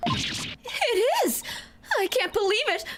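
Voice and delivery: high, upset outburst; shocked. Acted. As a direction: gasp it out in distress. Voicing shocked character.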